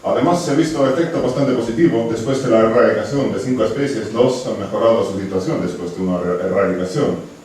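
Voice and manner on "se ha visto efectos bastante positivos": usual speaking voice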